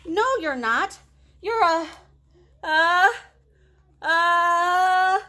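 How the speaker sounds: mouse voice. Acted. Mouse about to sneeze.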